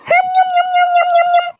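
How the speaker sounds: playful "yum-yum" munching noises. Acted. Rabbit eating a tasty carrot in the forest, munching happily. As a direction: gobble it up in rhythmic nom-noms.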